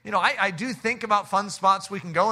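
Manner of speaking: usual speaking voice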